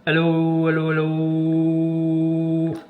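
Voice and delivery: drawn-out hello into the mic; testing the CB, calling hello; radio mic check. Real, not acted. Keying the radio mic, calling hello.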